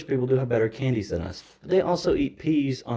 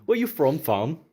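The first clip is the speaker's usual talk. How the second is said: put-on London bruv accent; 4 semitones higher, 4 dB louder, slower than usual. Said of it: "You're not doing the accent anymore".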